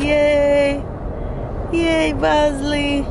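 high, drawn-out yay; cheering for the dog. Real, not acted. Owner cheering up a nervous dog, high drawn-out cheer. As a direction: cheer the pet on in a high, stretched voice.

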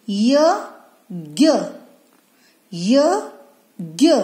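य and ज्ञ each sounded alone, with a gap after; letter by letter. Shown as a demonstration. Teacher showing kids how letters join, letter by letter. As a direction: spell out य, then ज्ञ, as separate sounds. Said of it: "दो व्यंजन जोड़कर जो शब्द बनेगा".

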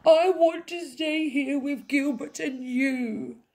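high, loud tearful whine; sobbing. A narrator's reading. Princess pleading with Mum to stay, sobbing. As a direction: plead in a child's wail. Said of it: "sobbed the little princess".

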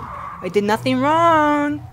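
high, loud and slow wail; desperate wail. Real, not acted. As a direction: wail your innocence. Cornered by monster, pleading.

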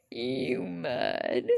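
low, drawn-out taunt, falling at the end; teasing low voice. Pretend. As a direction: drop deep and stretch both words. Repeats the tease in another voice.

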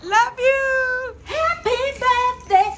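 sweet birthday wish; affectionate. Real, not acted. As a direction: coo it affectionately. Cast member wishing happy birthday.

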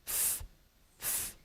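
"S" said as th, tongue between teeth; lisped S. Shown as a demonstration. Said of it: "Dişlerin arasını alarak okuduğun".

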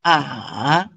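"A" dipping low, then rising at the end; drawn-out "A" that sinks and turns back up; third tone. Shown as a demonstration. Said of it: "there's a turn of the tone".

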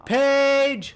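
high, loud holler of a name; calling out. Real, not acted. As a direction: holler to someone far away. Calling out to Paige.

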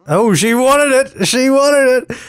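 high, delighted squeal of triumph; real thrilled cheer at the game; gleeful squeal. Real, not acted. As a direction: squeal it like gossip come true. Sim accepts the kiss.

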